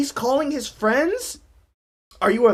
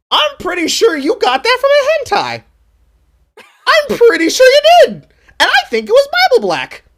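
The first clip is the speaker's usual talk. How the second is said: pitched up, louder mock accusation; teasing jab; 11 semitones higher, 7 dB louder than usual. Real, not acted. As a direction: needle her with a knowing jab.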